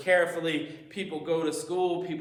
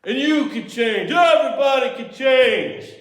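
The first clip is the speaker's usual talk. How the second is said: louder and higher, building to a passionate call; rising acted cry; 8 semitones higher, 8 dB louder than usual. Speaker opens talk with a rousing quote, building to a loud call. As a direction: build it like a rallying speech.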